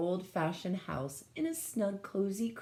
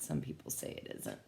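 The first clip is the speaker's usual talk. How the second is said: hushed aside; 4 semitones lower, 9 dB quieter, faster than usual. Real, not acted. Aside on Pluto debate.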